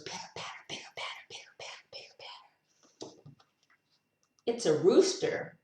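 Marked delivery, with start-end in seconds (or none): 0.0-3.2 quick whispered patter, fading away; whispered rain patter. Pretend. Reader showing how rain goes, whispering quickly. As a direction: whisper it fast like light raindrops, ever softer. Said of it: "And the rain goes".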